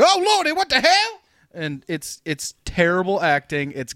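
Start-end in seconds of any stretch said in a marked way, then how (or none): 0.0-1.2 very high-pitched mock shriek; hammed-up alarm, voice shooting way up; imitated startled cop. Podcaster mocking the cop's terrible acting at shapeshifting, exaggerated startled cry.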